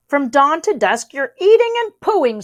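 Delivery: high, loud grumbling rabbit. A narrator's reading. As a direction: whine it like a fed-up rabbit. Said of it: "said Rabbit gloomily".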